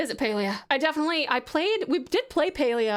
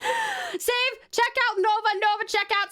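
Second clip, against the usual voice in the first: calling out; 9 semitones higher than usual. Real, not acted. Calling out to a viewer.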